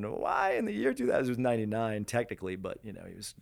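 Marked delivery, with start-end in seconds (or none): 0.0-1.2 high put-on voice quoting a line; high-pitched mock questioning; Reggie McDaniels impression. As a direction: echo it high and theatrical. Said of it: "I remember that line".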